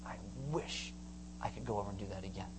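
soft, low wistful voice; quiet regret. Retold or imitated. Rich young man, now old, recalls Jesus' invite, quietly regretful. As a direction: say it hushed and low, looking back with regret.

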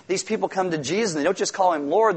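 ordinary talk, nothing marked

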